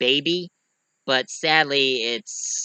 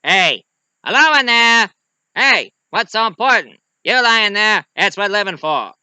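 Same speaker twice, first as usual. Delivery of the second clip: high, loud shouted movie quote; calling out; 6 semitones higher, 7 dB louder than usual. Acted. Reviewer acting out a miracle worker's call, loud shout. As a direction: holler down to someone lying there.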